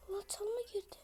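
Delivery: hushed whisper, enemy close by. Real, not acted. Gamer hiding in a bush, tense whisper. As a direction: whisper so the enemy won't hear.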